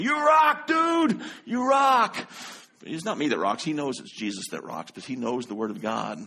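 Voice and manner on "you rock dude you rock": high loud cheering; excited shout. Retold or imitated. Cord keeps cheering the preacher on, high loud shout. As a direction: cheer like a fan. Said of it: "he kept saying".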